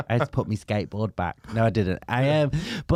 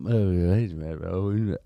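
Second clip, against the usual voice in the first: put-on drunk Welsh mumble; 5 semitones lower than usual. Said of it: "but Welsh. It was just Welsh noise".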